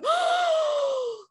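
high sharp gasp of horror; shocked gasp. Retold or imitated. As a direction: gasp behind a covered mouth. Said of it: "covering my mouth going".

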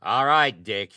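loud, high sharp snap; a loud angry snap at Dick; snapped. Acted. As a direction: bite the words off at him. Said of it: "he snapped".

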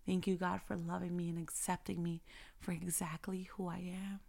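soft, hushed prayer of thanks; quiet prayer. Pretend. Talking to God in thanks, soft and hushed. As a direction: pray it softly, close to a whisper.

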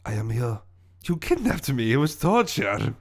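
aggrieved French villain; hammy French-accented lament. Acted. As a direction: state your grievance in a thick French accent. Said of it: "my French accent".

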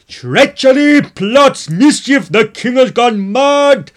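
full-force high yell of alarm; excited yelling. A narrator's reading. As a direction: scream the warning at the top of your voice. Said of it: "he was yelling".

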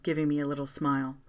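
ordinary delivery

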